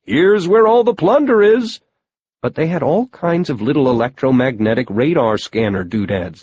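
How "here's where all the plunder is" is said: high, raised announcing voice; mock shouting. A narrator's reading. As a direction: imitate a shouted advertisement, pitched way up. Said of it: "pyramid above ground shouting".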